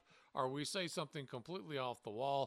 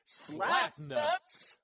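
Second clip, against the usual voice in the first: higher, rising shouted catchphrase; catchphrase call; 10 semitones higher than usual. Shown as a demonstration. Host leads the cohost in a rising catchphrase call. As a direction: call it out together like a chant. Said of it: "Let's say it together".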